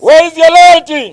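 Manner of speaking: high booming rally shout; booming ideological slogans. Real, not acted. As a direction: call out to the crowd at full force. Call and response slogans.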